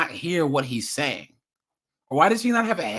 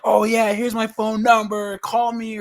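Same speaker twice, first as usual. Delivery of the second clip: put-on higher, louder, carefree teen chatter; naive teen voice; 7 semitones higher, 5 dB louder than usual. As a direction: play a clueless kid giving out info. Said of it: "You would be like".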